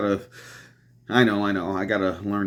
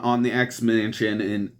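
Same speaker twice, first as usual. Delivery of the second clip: normal talking voice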